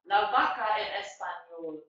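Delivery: normal talking voice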